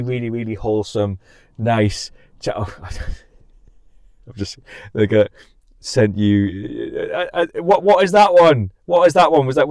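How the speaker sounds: normal talking voice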